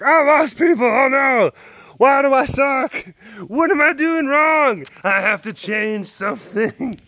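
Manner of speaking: over-the-top worried creator; mock panicked creator. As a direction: squeal it like a melodramatic youtuber.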